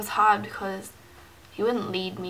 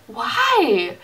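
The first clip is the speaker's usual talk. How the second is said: a sharp, louder, higher why; indignant why; 5 semitones higher, 8 dB louder than usual. Retold or imitated. Reading his texts beside her friend, a loud protesting why. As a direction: push back at him, louder.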